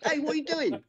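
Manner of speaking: high, loud mock British greeting; British accent. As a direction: pop into a hammy British accent. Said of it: "horrible British accent".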